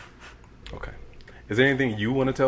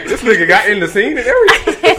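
cracking up loud; high laughing burst; 11 dB louder than usual. Real, not acted. Laughing at the friend's entrance.

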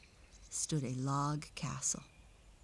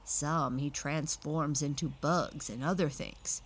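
plain reading voice

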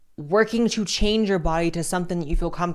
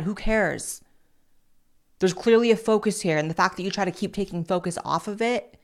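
normal talking voice

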